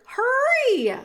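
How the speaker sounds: high, loud, urgent yell; shouted. A narrator's reading. Cook urges Meg to hurry, shrill shout. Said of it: "shouted the cook".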